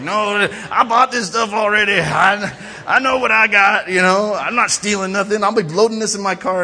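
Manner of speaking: loud, high indignant rant; acted-out customer tantrum; huge attitude. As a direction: protest your innocence at full volume. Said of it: "got this huge attitude".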